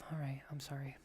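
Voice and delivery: backing off in a hushed sarcastic sorry; whispered mock apology. Apologising to the humourless manager in a whisper. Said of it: "you're just like".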